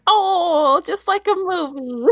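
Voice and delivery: high, laughing swoon. Real, not acted. Laughing to friends that it was like a movie, high giggly swoon. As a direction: squeal it like a smitten fan.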